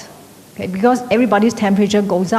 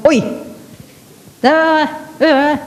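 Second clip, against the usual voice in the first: a hammed-up shrill call; nagging wife; 7 semitones higher than usual. Wife's usual harsh phone call.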